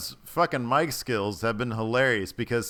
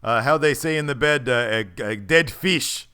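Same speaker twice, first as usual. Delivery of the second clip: loud, raised wrestler put-down; Rusev impression; 4 semitones higher, 6 dB louder than usual. Wrestler insulting someone on the lawn, loud halting impression. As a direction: grope loudly for the insult in a mock voice. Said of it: "and he says".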